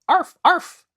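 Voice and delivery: very high, loud yapping bark; barking dog. Acted. Dog barks at the oar, high yap. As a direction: bark hard while rowing.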